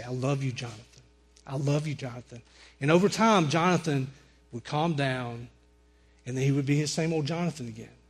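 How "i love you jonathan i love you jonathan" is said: low and soft, gently repeated; hushed, steady words of love, retold; soothing reassurance. George King calming Jonathan on the ground, soothing.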